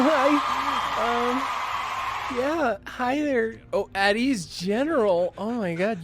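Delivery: high, halting, drawn-out gushing; hammed-up crush, slow and high; flustered, smitten greeting. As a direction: act starstruck, pitched well up. Acted flustered meeting with Garrus.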